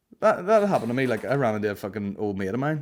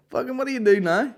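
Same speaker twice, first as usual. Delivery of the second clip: high, loud, excited Belfast bloke voice; mocking the bar guy; 13 semitones higher, 5 dB louder than usual. Comedian mimics a rude bar guy recognising him, high and loud. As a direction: ham up a nosy punter spotting a comic. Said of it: "this guy's like".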